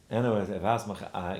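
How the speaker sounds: usual speaking voice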